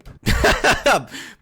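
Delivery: airy high burst of laughter; high breathless laugh. Real, not acted. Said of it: "She's laughing at it".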